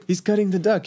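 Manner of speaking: high, scornful French chef voice; French accent, mocking complaint. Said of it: "in his French accent".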